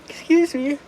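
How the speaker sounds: words stretched by a yawn. Real, not acted. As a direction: say it mid-yawn. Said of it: "don't let my yawn".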